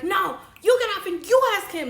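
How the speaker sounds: high, loud, upset order; retold yelling. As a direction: yell it at a boyfriend, re-enacting. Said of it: "I'm yelling at him".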